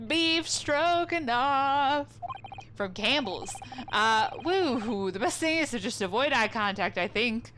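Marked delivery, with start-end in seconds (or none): 0.1-2.1 very slow, relishing each syllable; amused repeat. Real, not acted. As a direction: savour the silly name. Savouring the silly name.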